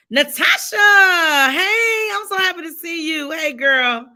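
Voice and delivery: high, loud, delighted shout of hello; excited greeting. Real, not acted. Host spots a friend joining the livestream, excited greeting. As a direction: call out to a friend with drawn-out joy.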